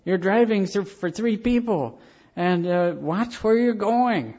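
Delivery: put-on urgent wife warning about the baby; pleading wife. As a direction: plead with him like a worried young mother. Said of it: "And his wife said".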